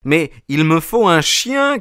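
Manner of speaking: put-on calling up to the old man insistently; cry out. A narrator's reading. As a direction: insist loudly up at the window. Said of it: "criai-je".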